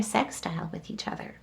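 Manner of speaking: hushed, voiceless whisper. Acted. Tarot reader to viewers, revealing the aspect in a whisper. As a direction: let it out as a breathy secret.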